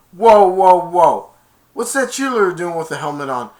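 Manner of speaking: loud startled whoa; surprised shout. Retold or imitated. Spike's dad seeing Becky in a helmet, loud startled shout. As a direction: react in disbelief.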